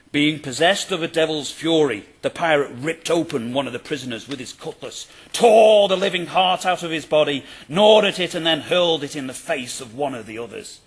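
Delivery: loud, gory dramatic read, peaking on the heart. Acted. Lecturer reads a grisly pirate account to listeners, dramatic and loud. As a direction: declaim it like a horror tale. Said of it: "Note, for example, this early piece".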